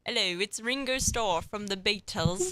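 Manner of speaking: slow, put-on British accent. Teen host showing off a celebrity British accent. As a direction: draw it out in a mock British accent. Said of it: "I sound like [name] when I talk".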